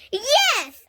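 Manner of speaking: high loud yes; yell. A narrator's reading. Junie B yelling agreement at the boy, high and loud. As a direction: shout it in agreement. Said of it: "I yelled".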